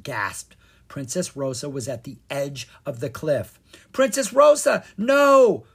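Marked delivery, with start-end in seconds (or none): 3.9-5.6 high, loud cry of alarm. A narrator's reading. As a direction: shout a desperate warning to stop her. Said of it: "Kara cried".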